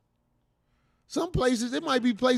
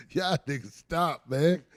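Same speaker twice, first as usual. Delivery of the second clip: ordinary delivery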